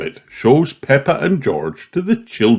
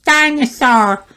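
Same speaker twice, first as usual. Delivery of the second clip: put-on much higher toddler voice; George's voice. A narrator's reading. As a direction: say it like a tiny piglet. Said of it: "says George".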